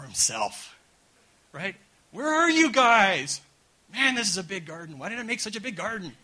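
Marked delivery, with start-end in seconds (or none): 2.1-6.2 mock confused God. Preacher voicing a bewildered God calling through the garden. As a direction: play God lost in his own big garden.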